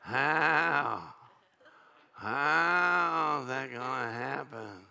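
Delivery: a doubter stuck wondering, drawn out; lightly acted anxious believer second-guessing; worried doubt. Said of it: "don't start thinking".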